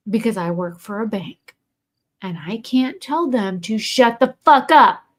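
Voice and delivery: normal talking voice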